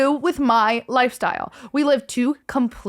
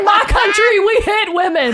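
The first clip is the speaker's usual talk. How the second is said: much higher and louder through laughter; laughing mock tough-guy; 12 semitones higher, 8 dB louder than usual. Friend jumps in with a mock-tough line, laughing hard. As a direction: deliver an absurd tough line while cracking up.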